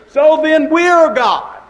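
"we're God" rings out high and loud; preaching climax. Real, not acted. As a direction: lift it up like a revelation.

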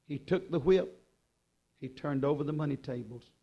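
ordinary talk

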